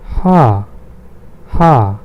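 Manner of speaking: Ha said as a long "hah"; Ha as the German name of H; German letter H. Shown as a demonstration. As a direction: breathe out the h in Ha, open long "ah". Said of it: "H is".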